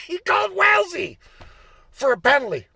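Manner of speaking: high, incredulous shout of outrage; can't believe the call, yell it; outraged disbelief. Retold or imitated. Player ranting at the referee about the penalty, outraged shout.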